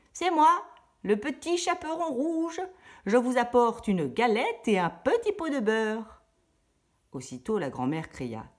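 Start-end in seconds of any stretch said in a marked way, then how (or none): 0.1-6.2 much higher, put-on little girl voice; the wolf squeaks to pass as the girl; wolf faking a little girl. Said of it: "Imitant une voix de petite fille". (le loup imite une voix de petite fille)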